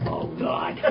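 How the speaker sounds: low, hushed mock dread; quiet, low-pitched inward plea; dreading aside. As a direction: say it under your breath, sinking low. Waiting nervously for her answer.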